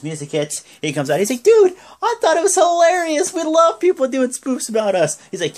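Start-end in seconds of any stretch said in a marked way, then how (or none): 1.4-5.2 very high, loud gushing; mock enthusiasm. Wrestler answering the spoof of him, high mock delight. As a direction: imitate an over-the-top delighted reply.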